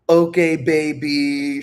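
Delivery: slow, put-on "fine, you win"; mock resigned girlfriend. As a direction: stretch it out like an exasperated partner conceding. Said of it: "She's like that".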